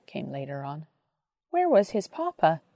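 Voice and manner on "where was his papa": put-on a child's anxious question, slightly higher; worried little voice asking. A narrator's reading. A child at Jesus' knee asks, anxiously. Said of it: "asked an anxious voice".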